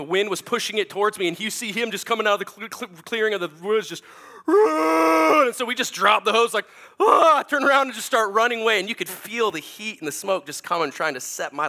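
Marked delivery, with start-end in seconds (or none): 4.4-5.5 high, loud shouted order; buddy yelling from the clearing; retold yell. Buddy warns of fire. 7.0-7.4 high, loud retold scream; mock scream. Fleeing the fire.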